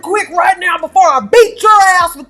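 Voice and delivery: high, loud mock rant; imitating furious Angela. A host acting out Angela raging at Kobe, mock yelling. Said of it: "That made her so mad".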